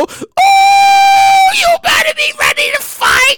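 very high, loud egging-on; rowdy whoop. Acted. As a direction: whoop it up before a fight. Whooping at pay in pennies.

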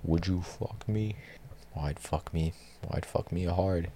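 soft, slow creepy come-on; creepy seductive voice. Retold or imitated. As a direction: purr it softly to the mirror. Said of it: "he's just going".

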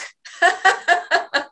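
high relieved laugh; relieved laugh at found keys. Shown as a demonstration. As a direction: release it in a big laugh. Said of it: "laugh it off".

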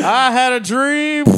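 high, slow mock orator; parodied grand speechmaking; Martin Luther King parody. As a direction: draw it out like a spoof speech. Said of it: "Fucking farting Luther King".

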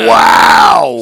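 loud, high drawn-out wow; real whooped reaction to a mishap; amazed outburst. Real, not acted. Reacting to spilled beer amid laughter.

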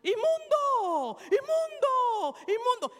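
drawn-out high shouts of unclean; long anguished wailing shout; leper's cry. Retold or imitated. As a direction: call it out like an outcast leper. Lepers made to call out.

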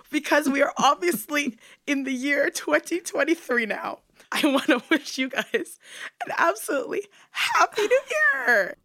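squealing happy New Year, laughing; excited laughing. Real, not acted. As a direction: gush through giggles. Gushing New Year wish.

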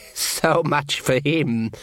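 slow, drawn-out elf, lightly voiced; a lightly played sly elf, slow; cold elf voice. Elf mocking Sean, slow and cold.

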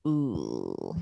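deep, quiet drawn ooh; low ooh. Real, not acted. Dramatic reaction.

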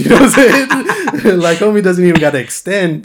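real laughter breaking through, high and loud; laughing. Real, not acted. Storyteller laughing to his friend about the danger. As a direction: laugh it out in disbelief. Laughing over being so close.

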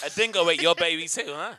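put-on loud, teasing Australian accent; mock Australian accent. Guest mocks co-host's accent back, loud mock Australian accent. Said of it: "like you from Australasia".

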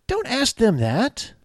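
high, slow, drawn-out don't; emphatic scolding. Real, not acted. Cop warning colleagues, slow emphatic high plea. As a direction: plead with them not to ask it.

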